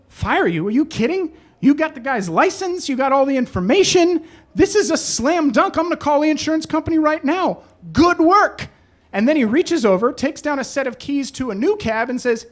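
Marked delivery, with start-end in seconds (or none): 0.1-8.7 higher-pitched, excited boss impression; delighted boss. Boss reassuring a driver who expected firing, delighted. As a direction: gush with approval, acting out a thrilled boss.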